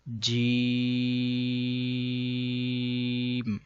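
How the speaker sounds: جیم with a very long, steady held ee; elongated letter name. Shown as a demonstration. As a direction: stretch the vowel of جیم evenly for about three seconds. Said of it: "تین الف یعنی کہ تین second لمبا کریں گے". (جیم کو تین الف لمبا کھینچ کر)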